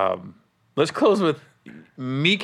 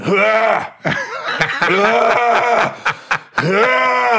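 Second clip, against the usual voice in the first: loud, higher, barking coughs; mock hacking cough; 6 semitones higher, 3 dB louder, much slower than usual. Friend imitating the sick comic coughing in the green room. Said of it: "just going".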